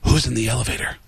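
low, hushed storyteller voice with dramatic pauses; Alec Baldwin impression. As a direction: drop deep and measure out the story for suspense. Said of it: "and he says".

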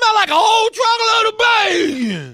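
high, loud call to a neighbor; excited neighbor yell. Acted. Host yells to his neighbor Ryan about the bacon smell.

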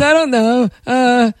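Napoleon Dynamite-like dim drone; dopey boyfriend. Acted. Boyfriend dithering about dinner, dopey drawl. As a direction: groan it out, vague and undecided. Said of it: "he's always like".

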